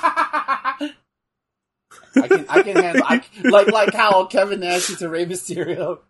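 laughing. Real, not acted. Laughing while talking.

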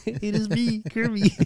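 high, froggy Muppet voice; Kermit-like voice. Pretend. Joking that the Kermit voice is trying to come out, high and froggy. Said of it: "You trying to come out?".

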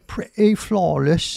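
"pretty flawless" slow, in a put-on British accent, the t dropped; a hammed-up British accent on "pretty flawless". Shown as a demonstration. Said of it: "Or should I say".

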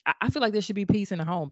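ordinary delivery

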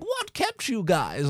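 slow mock dubbed line, Raiden; a light imitation of Raiden's stilted voice; imitating bad dubbing. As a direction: drawl it like badly dubbed dialogue. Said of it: "in his terrible A D R accent".

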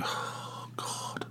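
breathless whispered oh God; whispered dread. Retold or imitated. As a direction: drop to a despairing whisper. Dreading the early shift.

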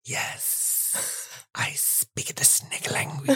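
slow, hissed serpent whisper; snake whisper. Acted. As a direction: Parseltongue whisper. Tom replies in Parseltongue.

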